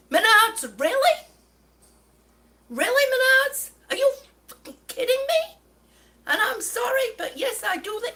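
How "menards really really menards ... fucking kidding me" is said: loud, high-pitched incredulous outburst; exasperated outrage. Real, not acted. Customer venting at Menards over botched tile order, outraged. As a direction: call the store out in disbelief, voice jumping up.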